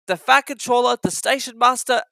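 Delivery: ordinary delivery, nothing marked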